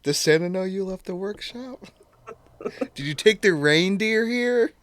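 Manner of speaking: high, innocent Buddy voice; imitating Buddy. Buddy teasing the elf.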